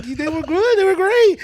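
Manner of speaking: loud, high mock pleading; hammed-up panicked appeasing; frantic placating. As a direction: blurt compliments to save yourself. Placating the furious friend, retold.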